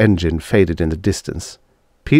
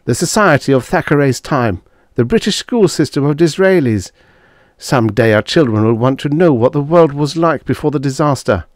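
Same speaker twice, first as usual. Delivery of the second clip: raised, restrained insistence; fighting back shouting; 8 semitones higher, 4 dB louder than usual. A narrator's reading. Husband justifies keeping books for children, restraining a shout. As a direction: argue hard without shouting. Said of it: "fighting an urge to shout".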